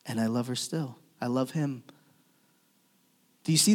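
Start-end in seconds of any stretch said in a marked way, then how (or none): 0.0-1.8 hushed, low tenderness; tender. Acted. Preacher voicing Jesus's love for the dead, tender and hushed. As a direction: drop to a soft, low declaration of love.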